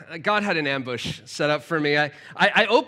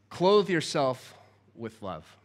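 slow earnest emphasis; 4 semitones lower, 4 dB quieter, slower than usual. Real, not acted. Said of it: "closing key line slowly".

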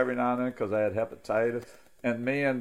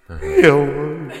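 digital echo; 3 semitones higher, 9 dB louder than usual. Shown as a demonstration. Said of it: "Give me some echo".

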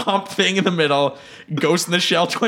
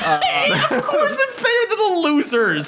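laughing. Real, not acted. Laughing reply.

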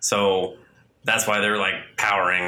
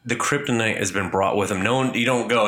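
normal talking voice

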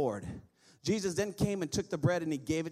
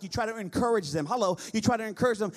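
ordinary talk, nothing marked

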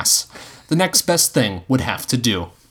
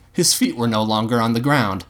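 ordinary delivery

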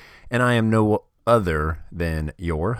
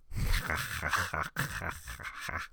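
villain laugh; 9 dB quieter than usual. Acted. Fake villain gloats after hacking.

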